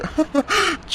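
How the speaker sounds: high, soft chuckle; gloating chuckle. Acted. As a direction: snicker softly at a secret scheme. Villain boasts before leaving.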